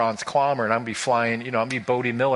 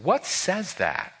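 God questioning; 6 semitones higher, slower than usual. Acted. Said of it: "God is looking at the heart and says".

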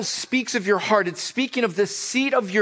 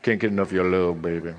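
deep morning-voice soul crooner; very low, smooth lover's line; 13 semitones lower, faster than usual. Shown as a demonstration. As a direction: sink to the bottom of your range and sweet-talk.

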